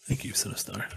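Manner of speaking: whisper. Acted. Thanking a viewer in a whisper.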